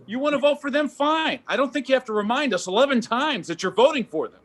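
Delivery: high-pitched incredulous rant at the neighbor; mock exasperated rant. Comic telling off the neighbor with twelve signs, pitched high in disbelief. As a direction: scoff at the sign owner's repetition.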